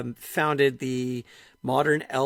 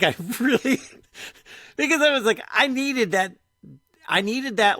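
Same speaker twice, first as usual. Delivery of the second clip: high, loud, eager outpouring; excited burst; 8 semitones higher, 4 dB louder than usual. Real, not acted. Telling a friend how much the advice helped, high excited gush. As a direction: gush with sudden excitement, pitched up.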